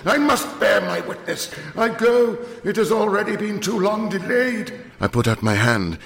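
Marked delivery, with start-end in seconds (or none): 0.1-4.7 raving curate. Acted. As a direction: declaim it as he strides off. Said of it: "at the top of his voice".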